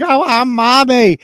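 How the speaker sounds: high, loud, drawn-out mock wail; whiny child pretending to cry; imitated fake cry. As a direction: mimic a kid's put-on crying for mommy. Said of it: "a real cry and a fake cry".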